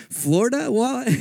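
high, squeaky disbelief; a guy just told he's going to Florida rehab, high and incredulous. Retold or imitated. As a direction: ask it like you can't believe your ears. His reaction to rehab in Florida.

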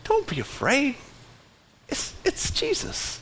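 soft, rising, almost whispered; breathy high whisper of comfort; gentle reassurance. Retold or imitated. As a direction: hush a frightened friend tenderly. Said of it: "he's saying".

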